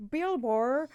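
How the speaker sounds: high, drawn-out mock Australian accent; bad Australian accent. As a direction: put on a terrible, exaggerated Aussie accent. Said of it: "bad Australian accent".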